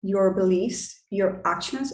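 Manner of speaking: usual speaking voice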